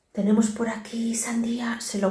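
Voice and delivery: softly whispered so as not to disturb. Real, not acted. Mother whispering over the fruit beside her son. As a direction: hush your voice to a whisper. Quiet near resting son.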